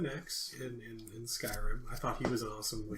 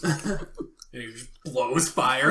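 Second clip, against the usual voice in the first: ordinary delivery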